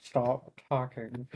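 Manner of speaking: low, slow, through clenched teeth; through gritted teeth. Acted. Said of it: "She says through teeth".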